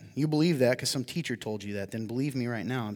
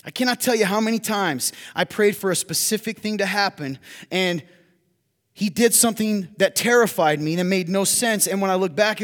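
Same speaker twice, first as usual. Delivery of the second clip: loud, high, driving sermon voice; preaching at full force; 7 semitones higher, 9 dB louder than usual. Real, not acted. Pastor admitting his limits to the congregation, preaching loud. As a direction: preach it hard to the room.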